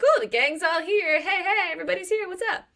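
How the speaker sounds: high, sing-out hello to the gang; hammed-up happy welcome; cheery greeting. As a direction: greet everyone in a high, delighted voice. Acting cheerful greeting to friends.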